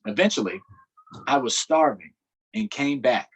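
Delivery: steady read-aloud storytelling voice; story narration. A narrator's reading. Teacher reading a book aloud to children, narrating. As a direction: read the story to the class, measured.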